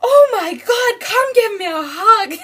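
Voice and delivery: high, loud gushing imitation of a thrilled grandmother; hammed-up delighted squeal of words; excited grandma. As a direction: act out a grandma bursting with joy. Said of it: "she was so excited".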